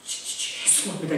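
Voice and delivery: tearful stammer. Retold or imitated. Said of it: "눈에 눈물이 거슬면서 하면서".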